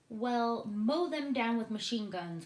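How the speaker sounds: loud, urgent army chief; booming military cry; cried out. A narrator's reading. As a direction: bark out the order to mow them down. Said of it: "cried the head of the army".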